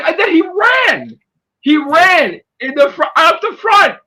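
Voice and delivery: loud, high and emphatic; excited retelling. Real, not acted. Telling how the guy fled, loud excited delivery. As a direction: punch out the climax of the story. Excited climax of fight story.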